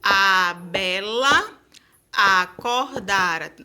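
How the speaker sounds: slow scanning of a verse; poem recital. Shown as a demonstration. Teacher scanning the verse to count syllables. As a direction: stretch each syllable of the verse. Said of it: "quantas sílabas aí".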